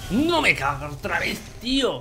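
frustrated outburst. Real, not acted. Frustrated at dying again.

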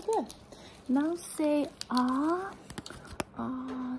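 ordinary talk

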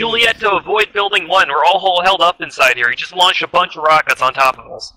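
high, loud, pressed combat call; urgent shouting. Real, not acted. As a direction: shout it over the gunfire. Pinned down under rocket fire.

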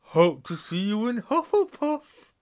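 high, loud, merry friar; jolly booming friar. Acted. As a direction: cheerfully invite them to his house. Friar to first years.

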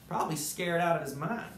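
usual speaking voice

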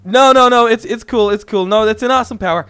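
flustered. Acted. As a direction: rush to stop him, flustered. Hastily stopping Max.

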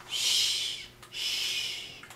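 very soft, drawn-out "shh"; hushing. Acted. Grandma hushes for sleeping bunnies, soft shushing. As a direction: hush gently, twice.

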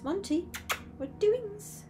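pet voice. Real, not acted. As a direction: lift right up and coo at the duck. Talking to her pet duck.

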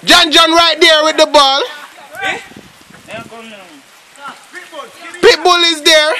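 energetic live commentary; excited play-by-play. Real, not acted. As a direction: call each player with urgency. Calling live play with urgency.

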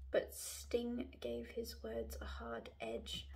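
reading the line aloud in a whisper; whispering the sentence from the book. Real, not acted. Reading the book under breath.

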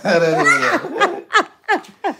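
loud, high burst of laughter; words lost in laughing; cracking up. Real, not acted. Partner abandons accent demo, laughing.

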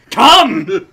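high, very loud one-word yell; all-caps shout. Retold or imitated. Nat typing in YouTube comments, all-caps shout. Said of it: "all caps with three exclamation points".

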